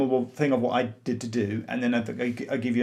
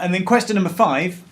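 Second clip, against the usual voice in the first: raised announcer voice; quizmaster announcement; 4 semitones higher, 6 dB louder than usual. Acted. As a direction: call out the next question.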